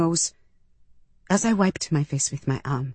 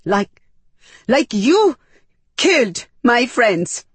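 high, loud, halting outburst; furious, stopping and restarting; angry, breath catching; 6 semitones higher, 6 dB louder, slower than usual. A narrator's reading. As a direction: choke out the accusation in broken pieces. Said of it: "I was so angry that I couldn't catch my breath".

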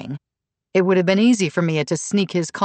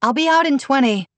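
raised, high-pitched call; 9 semitones higher, 4 dB louder than usual. A narrator's reading. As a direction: daughter calling back through a closed door. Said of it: "Mia called from the bathroom".

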